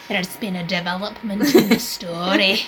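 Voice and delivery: put-on Scottish accent. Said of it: "is that your Scottish accent".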